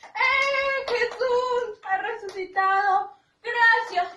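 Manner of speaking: high, loud drawn-out cheer; crowd shouting. A narrator's reading. Everyone looking at the sky and cheering the resurrection, shouting. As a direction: cry out joyfully like a crowd. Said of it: "comenzaron a gritar y a decir".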